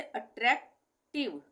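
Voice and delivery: अट्रैक्टिव split as attrak, pause, tiv; word broken apart. Shown as a demonstration. Teacher separating word parts, अट्रैक्टिव in two pieces. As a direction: hold off the tiv at the end of अट्रैक्टिव. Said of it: "अलग-अलग हो गया ना".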